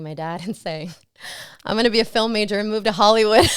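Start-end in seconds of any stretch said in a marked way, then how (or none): 1.6-3.5 quick, louder, higher gush to dad; acted-out eager declaration; retold excited announcement. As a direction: blurt out big news with a laugh in it.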